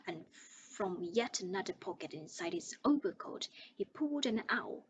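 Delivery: ordinary delivery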